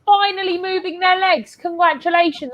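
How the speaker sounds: high-pitched mock congratulations; sarcastic amazement. Real, not acted. Fan mocking players for finally running, sarcastic amazement. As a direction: heap scornful praise on them.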